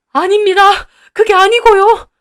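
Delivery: very high, loud, desperate; panicked denial. Acted. Owner denying everything in a high loud panic. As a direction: protest desperately.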